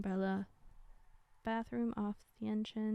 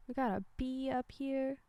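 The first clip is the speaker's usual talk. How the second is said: usual speaking voice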